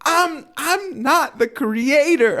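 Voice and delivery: imitating an earnest preacher pleading with the crowd, high and slow; earnest plea. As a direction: beg the crowd to get it, stretched out and high. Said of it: "just really quickly and really earnestly going".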